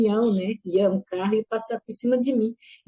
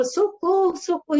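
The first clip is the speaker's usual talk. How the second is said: retold cry for help; 7 semitones higher than usual. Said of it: "E eu gritava".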